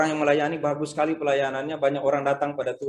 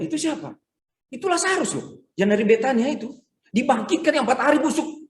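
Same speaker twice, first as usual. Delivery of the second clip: amazed onlookers; 8 semitones higher than usual. Retold or imitated. Onlookers recognise risen Lazarus.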